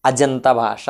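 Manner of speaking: "అజంత భాష" fused, with voiced j; joined sandhi form. Shown as a demonstration. Teacher repeating what we say, "అజంత భాష" joined with j. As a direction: say "అజంత భాష" smoothly as ajanta bhasha. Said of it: "అన్నాం".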